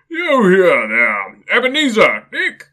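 big booming jolly merchant, loud and high; oily, rich, fat, jovial voice. Acted. Fezziwig calls his apprentices, loud and jovial. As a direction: boom it out like a fat, merry old boss. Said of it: "oily, rich, fat, jovial voice".

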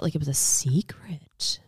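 hushed secret whisper. Pretend.

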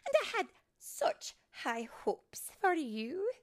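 high, loud, dramatic reproach; disappointed scolding. Acted. Fairy Mary shaking her head at Tink, disappointed. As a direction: let her hear your disappointment. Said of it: "shaking her head".

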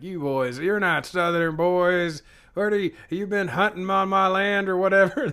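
gruff drawling hillbilly; a Cyclops growling at trespassers; Southern hunter voice. Retold or imitated. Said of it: "and he's like".

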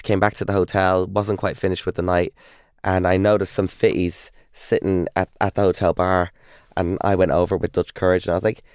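ordinary talk, nothing marked

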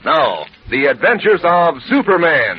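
slow, spelled-out sponsor tagline over music; ad tagline read. Acted. Announcer closing the Kellogg's ad, slow and emphatic. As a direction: spell the brand slowly like a jingle.

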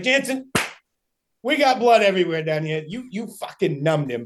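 retold buddy hollering good news; calling out excitedly. Nate calling up to Jansen about the blood trail, excited.

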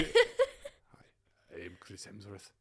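whispered mock Hemsworth voice that slips into Scottish; breathy botched celebrity accent, half-whispered; Chris Hemsworth impression. Boyfriend attempts Chris Hemsworth, lands on Scottish. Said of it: "No, that was Scottish".

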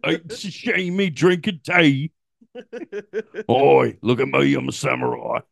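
hammed-up Cockney show-off; Cockney British. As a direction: put on a broad, silly Cockney accent. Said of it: "Cockney British?".

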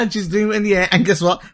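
usual speaking voice

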